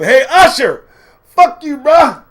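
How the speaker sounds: high, loud heckler's yell; yelled taunt. Acted. Man yelling at a singer on the escalator, shouted taunt. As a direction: holler it at him across the lobby. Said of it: "the man yelled at him".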